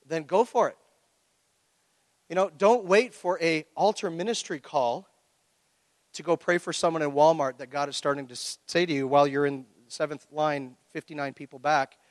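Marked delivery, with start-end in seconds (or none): none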